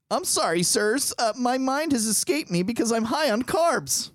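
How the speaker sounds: put-on high, dopey carb-drunk voice; high-pitched woozy impression of a co-host; Maddie Potts carb voice. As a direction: play someone spaced out on too many carbs. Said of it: "I tried to do a Maddie Potts, uh, carb voice".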